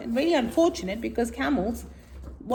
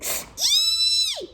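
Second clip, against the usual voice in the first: a very high hot-sand squeal; camel shriek. Acted. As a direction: squeal as the sand burns your hoof. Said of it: "she shrieked".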